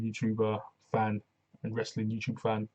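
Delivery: normal talking voice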